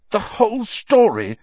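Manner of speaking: loud, high, incredulous echo; furious. A narrator's reading. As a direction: throw the words back angrily. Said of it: "a furious pounding in his ears".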